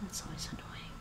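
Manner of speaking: whispered under the breath, annoyed; muttered whisper. Real, not acted. Streamer annoyed at frozen Chromebook, whispered aside.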